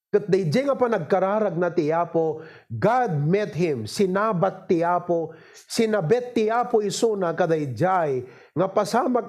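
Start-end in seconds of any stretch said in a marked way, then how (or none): none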